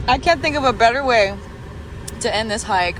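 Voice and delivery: loud, bright and delighted; thrilled, nearly shouting; excited. Real, not acted. Vlogger telling viewers about the hike, loudly excited.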